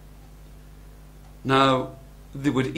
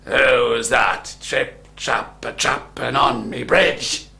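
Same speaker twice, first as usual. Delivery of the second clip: slow, menacing troll voice; big bad storybook troll; terrible troll; 2 semitones lower, 5 dB louder, slower than usual. A narrator's reading. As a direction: challenge the trespasser, drawing the words out. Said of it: "the terrible troll".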